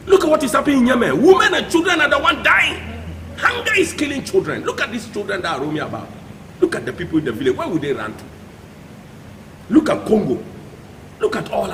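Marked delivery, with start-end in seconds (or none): 0.1-6.1 high, urgent, raised voice; impassioned outcry. Real, not acted. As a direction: cry out in alarm at the suffering. Impassioned plea about dying children.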